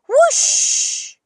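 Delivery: high, loud whoosh of falling; whooshing cry. Acted. Amelia Bedelia lands in leaves with a loud whoosh. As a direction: let the whoosh fly as she tumbles.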